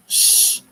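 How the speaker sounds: sharp, high unvoiced shh; playful shush. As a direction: shush a chatter loudly. Shushing Rachel in chat.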